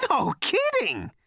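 high, slow, drawn-out yelp; astonished yelp. A narrator's reading. Prack hears Arthur's name, yelping in amazement. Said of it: "he yelped".

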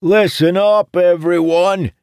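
loud, high-pitched call to a crowd; shouting. A narrator's reading. Jacob addressing the whole galley, shouting. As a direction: shout for everyone's attention. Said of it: "Jacob shouted".

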